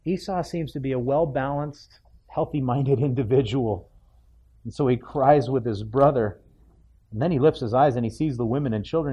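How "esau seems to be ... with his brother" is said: ordinary delivery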